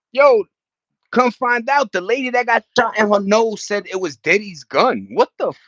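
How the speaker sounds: high, loud incredulous rant; outraged disbelief. Real, not acted. Streamer telling chat about [name], loud high outrage. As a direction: blurt it out in shock.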